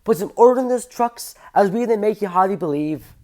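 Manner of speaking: put-on high-pitched homemade Scottish accent; badly faked Scots. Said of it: "that's my best Scottish accent".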